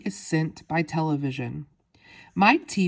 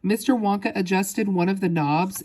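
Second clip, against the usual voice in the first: ordinary delivery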